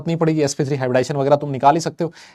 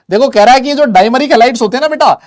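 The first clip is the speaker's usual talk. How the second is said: excited mock declaration; 8 semitones higher, 9 dB louder than usual. Teacher voicing a molecule excitedly.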